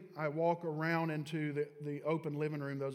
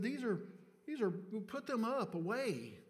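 higher, lecturing instructions; a mild mock of his own bossiness; bossy scolding; 7 semitones higher than usual. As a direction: tell her off like a know-it-all. Scolding his wife, retold.

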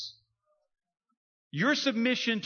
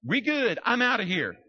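louder, higher, quick to bolt; eager exit; 6 semitones higher, 5 dB louder than usual. Retold or imitated. Preacher imagining his release, eager and louder. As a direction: grab the chance and go. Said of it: "I'm gonna be like".

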